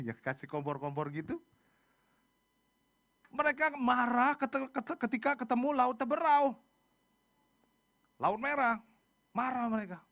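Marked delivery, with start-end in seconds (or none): 3.3-10.0 high, loud storytelling from the pulpit; emphatic preaching. Acted. Preacher tells congregation of Israel's anger, emphatic. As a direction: drive the account home forcefully.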